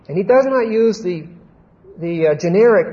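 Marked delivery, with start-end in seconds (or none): none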